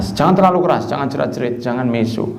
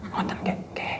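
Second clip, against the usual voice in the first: near-silent whispered reply; overly soft talker; 15 dB quieter than usual. Retold or imitated. Imitating the villager's polite answer, barely audible whisper. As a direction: imitate someone speaking too softly to hear. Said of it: "Itu kalau ngomong".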